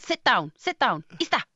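high-pitched order to a pet; dog command. Shown as a demonstration. Welsh singer translating her dog orders for the host, commanding voice. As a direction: give short commands as if to a dog. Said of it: "speak English to my dog".

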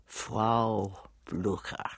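very slow, each word weighed; slow measured delivery. Shown as a demonstration. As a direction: take all the time in the world. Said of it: "But I said".

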